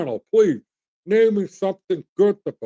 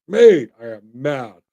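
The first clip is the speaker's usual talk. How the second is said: mad, slow and loud; 4 semitones lower, 7 dB louder, slower than usual. Real, not acted.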